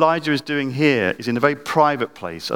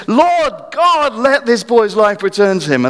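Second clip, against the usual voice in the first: much higher, louder plea; crying out again; 10 semitones higher, 5 dB louder, slower than usual. Retold or imitated. Elijah pleading again for the boy, louder and higher. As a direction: cry out harder the second time. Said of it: "Goes again".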